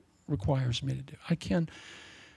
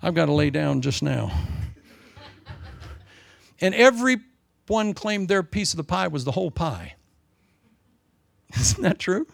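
ordinary talk, nothing marked

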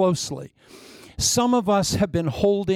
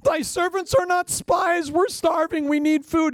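pleading brothers; 13 semitones higher than usual. Acted. Brothers begging Egypt's lord for food, high and pleading. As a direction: beg like frightened starving men. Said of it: "They go".

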